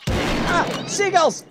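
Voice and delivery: high, loud startled cry; startled shout. Real, not acted. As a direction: yelp in sudden alarm. Sudden alarm at game enemies.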